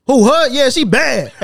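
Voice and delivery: loud, high acted boast; mock loud bravado. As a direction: play the brazen friend at volume. Acting out a confrontational friend.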